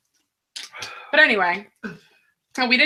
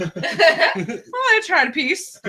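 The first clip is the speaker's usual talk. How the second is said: sheepish stoner; 7 semitones higher, much slower than usual. Retold or imitated. Wife voices stoned husband.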